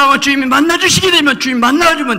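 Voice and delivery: 높고 크게 몰아치는 설교; 목청 높여 회중을 몰아붙이듯; 열정적 설교. Acted. (십자가를 전하며 높이 외치는 설교)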